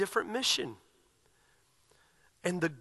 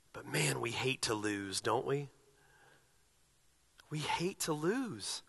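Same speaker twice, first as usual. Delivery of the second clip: quiet reflection; 4 semitones lower, 7 dB quieter than usual. Acted. Pastor admitting our hatred of losing, soft and rueful. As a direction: say it softly, letting it land.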